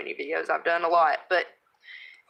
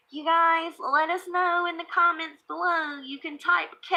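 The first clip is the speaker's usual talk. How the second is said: put-on higher, light kid's voice; imitating her son; 8 semitones higher than usual. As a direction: play the eager little boy host. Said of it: "He's like".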